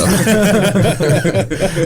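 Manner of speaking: a burst of high-pitched real laughter; big high laugh. Real, not acted. Telling his mom he lied about owning a tuxedo, bursting into high laughter.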